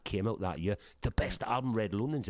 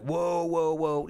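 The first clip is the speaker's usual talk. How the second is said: higher drawn-out whoa; lightly voiced, easing him back; mock calming whoa; 7 semitones higher, much slower than usual. [name] slowing down his excited friend, lightly mocked.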